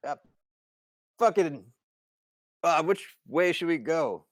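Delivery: loud, high, thwarted question; frustrated holler. Acted. Simon asking Steiny for the way, frustrated shout.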